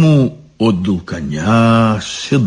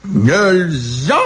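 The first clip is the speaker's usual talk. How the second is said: higher-pitched snapping retort; barked retort; 6 semitones higher than usual. A narrator's reading. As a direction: snap it back in a put-on voice. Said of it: "тоже рявкнула дочь". (рявкнуть в ответ)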